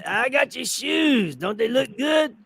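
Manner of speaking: proud slow mimicry; impression of Mister Brown. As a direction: ham up the old man showing off his shoes. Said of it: "Do your impression".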